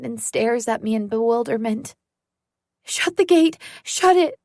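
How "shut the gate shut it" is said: high, loud panicked command; terrified girl screams at the stranger; frantic shout. Acted. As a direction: yell it in terror. Ordering man to close gate.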